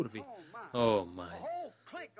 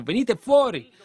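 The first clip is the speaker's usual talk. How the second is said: high, loud cry of come out; shouted call; 9 semitones higher, 8 dB louder than usual. Real, not acted. Preacher crying out to the congregation, loud and high. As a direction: fling the call out to the hall.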